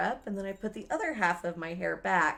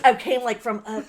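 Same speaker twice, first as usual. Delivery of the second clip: ordinary delivery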